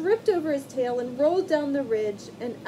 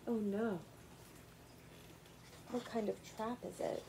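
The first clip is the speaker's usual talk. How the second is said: ordinary delivery, nothing marked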